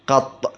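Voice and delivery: قط as qatt, ending on a held ط; fatha and doubled ط. Shown as a demonstration. Teacher reading the rule, قط as qatt with doubled ط. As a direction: say قط with short a and a doubled ط. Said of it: "بفتح القاف وتشديد الطاء".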